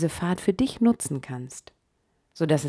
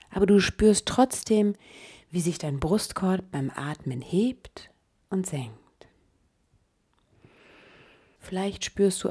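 guided meditation. Acted. Leads a meditation.

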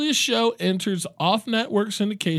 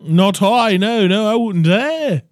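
put-on British accent; 4 semitones higher, 8 dB louder than usual. Denying it to the guv, exaggerated British accent. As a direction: swoop up and ham up the British protest. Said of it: "Can you do a British accent?".

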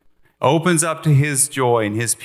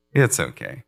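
much lower, hushed comfort; soothing God; 11 semitones lower than usual. Pretend. God reassuring a believer from the throne, low hushed soothing. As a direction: settle someone down gently. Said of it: "He's like".

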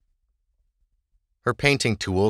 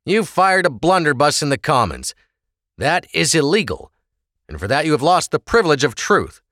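lightly voiced stern officer, raised; 7 semitones higher, 4 dB louder than usual. Officer scolding the prisoner, lightly stern. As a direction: lay down the law to him.

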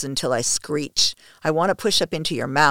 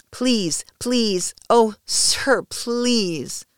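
higher-pitched pleading; desperate begging; 7 semitones higher, much slower than usual. A narrator's reading. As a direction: beg as if the words are dragged out of you. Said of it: "I beg with seemingly no volition, the words being dragged from my core".

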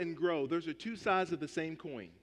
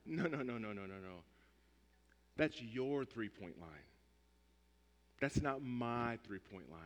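smug dismissal; 8 semitones lower, 9 dB quieter than usual. Retold or imitated. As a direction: wave it away, low and soft. Player rejecting the three-point line.

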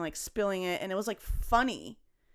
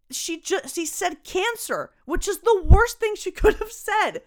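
laughing; 8 semitones higher, 8 dB louder than usual. Real, not acted. Laughing while retelling diagnosis.

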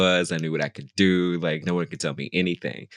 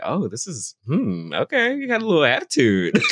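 rising, higher, amused self-appraisal; mock impressed; 7 semitones higher than usual. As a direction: size up a cheeky kid, playfully. Acts out amused reaction to himself.

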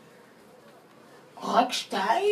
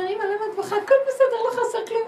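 put-on much higher, louder child's voice; sweet child reassuring his mother; child comforting mother; 12 semitones higher, 6 dB louder, faster than usual. A child tells his crying mother all is fine, high child voice. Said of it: "הילד אומר".

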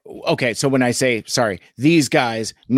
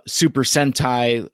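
ordinary talk, nothing marked

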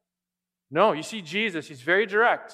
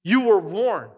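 louder stern warning; 3 semitones higher, 7 dB louder, slower than usual. Retold or imitated. Jesus warning Sadducees and Pharisees, louder. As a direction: bark the warning at them. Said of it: "he's pointing back to the Word of God".

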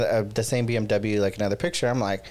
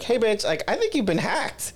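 urgent warning; 8 semitones higher than usual. Retold or imitated. Calling friend to warn her.

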